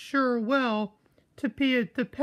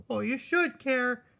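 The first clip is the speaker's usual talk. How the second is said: usual speaking voice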